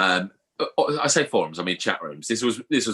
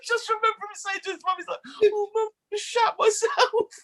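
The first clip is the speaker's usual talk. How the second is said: real laughing fit, voice pitched way up; helpless laughter. Real, not acted. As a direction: squeal it out through hysterics. Repeats punchline while cracking up.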